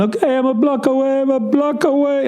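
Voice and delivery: high, mock driver voice; driver's voice. Car service driver insisting on phone, high mock voice. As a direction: squeak it like the clueless driver.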